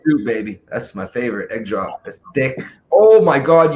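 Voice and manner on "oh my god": frustrated outburst. Real, not acted. Yelling at the game.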